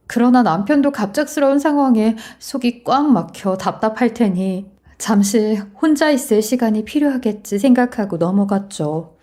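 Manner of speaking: plain reading voice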